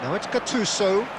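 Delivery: lively live play-by-play, rising with the action; fast sports commentary. Acted. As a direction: call the match as it unfolds.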